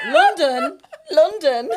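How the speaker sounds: put-on loud, high, laughing echo of the British accent; mock the posh-accent word through laughter; laughing mimicry. Co-host teasing the caller's London, laughing mimicry.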